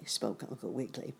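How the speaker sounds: ordinary delivery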